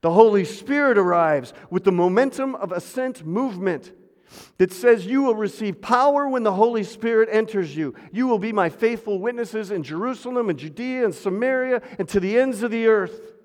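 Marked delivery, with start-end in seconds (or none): 5.1-13.3 raised voice reading the promise; preaching scripture. Acted. Preacher proclaiming the promise to the church, raised high. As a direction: proclaim it upward to the hall.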